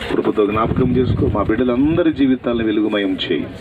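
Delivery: loud pleading prayer over the congregation; earnest, loud prayer voice; fervent prayer. Acted. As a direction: cry out to God on everyone's behalf. Leads prayer aloud.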